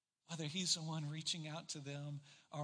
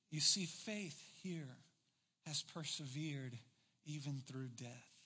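usual speaking voice

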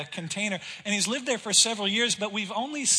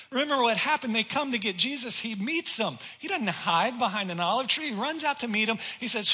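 ordinary talk

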